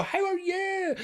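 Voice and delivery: chirpy put-on how are you; big brave face. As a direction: greet them with a brave fake smile. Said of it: "your big brave face comes on".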